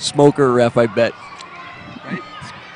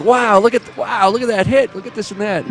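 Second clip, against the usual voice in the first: re-enacted wide-eyed wow, pitched high; mock amazement; 5 semitones higher than usual. Commentator retelling his stint helping officiate, mock amazement. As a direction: gush like an awestruck spectator. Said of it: "because I was so amazed".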